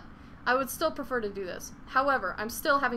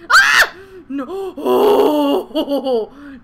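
much higher, louder real scream; panicked scream; 4 semitones higher, 16 dB louder, much slower than usual. Real, not acted. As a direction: shriek as the climb slips away. Nearly falling in game.

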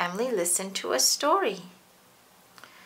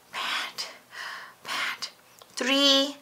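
panting; 4 semitones higher, much slower than usual. Pretend. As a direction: pretend to be out of breath. Exercise pretend.

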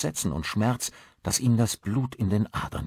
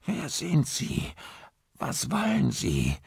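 choked fear; 8 semitones higher, slower than usual. A narrator's reading. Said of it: "die Worte blieben ihm in der Kehle stecken".

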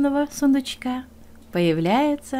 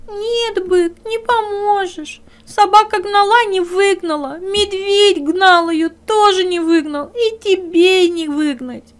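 high, louder crying hare; tearful hare; 9 semitones higher, 6 dB louder than usual. Acted. Hare tells the bear he can't help, tearful and high. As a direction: sob out that nobody can help.

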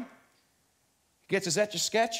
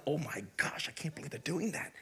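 hushed mock shock; 2 semitones lower, 8 dB quieter than usual. Preacher mimicking a God shocked at Eden, hushed mock shock. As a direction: gasp it under your breath, pretending to be scandalized.

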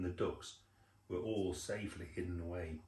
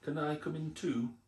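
high, pleading little fox; 8 semitones higher, 3 dB louder than usual. A narrator's reading. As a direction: beg to be let in, higher. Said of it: "looked very cold and hungry".

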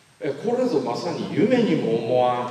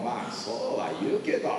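lower, softer reluctant rebuttal; dismissive brush-off; 4 semitones lower, 5 dB quieter than usual. Retold or imitated. Deflecting others' advice as his younger self, mildly dismissive. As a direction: brush advice aside with a mild, quiet objection. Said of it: "つって弾いてたんです".

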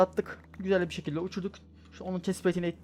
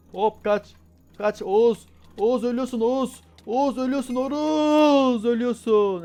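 much higher, louder panicked yells; shouting at Oğuz as he dies; frantic shouting; 8 semitones higher, 10 dB louder, slower than usual. Real, not acted. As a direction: scream a warning at a dying friend. Friend Oğuz dying in game.

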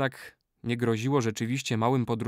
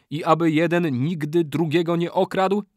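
high, loud oath-giving; ritual incantation; 6 semitones higher, 5 dB louder than usual. A narrator's reading. Old man charges the brothers never to steal, proclaiming. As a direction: proclaim the vow.

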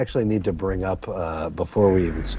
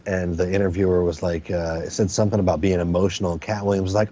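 normal talking voice

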